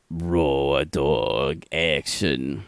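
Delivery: low, slow movie-trailer voice; mock announcer. Host introducing the next segment, low and slow like a trailer. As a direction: drop way down and stretch it out.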